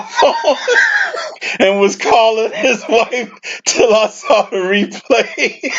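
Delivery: cracking up while reading aloud; laughing. Real, not acted. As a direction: try to read on through real laughter. Cracks up reading funny comment.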